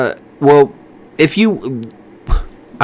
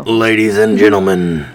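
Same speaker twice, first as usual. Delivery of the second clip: deep announcer voice; 4 semitones lower than usual. Shown as a demonstration. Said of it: "What if I went deeper?".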